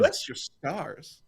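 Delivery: slow Southern drawl on every word; Southern accent. Acted. Repeating a phrase on request, slow Southern accent. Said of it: "the only Southern accent I do".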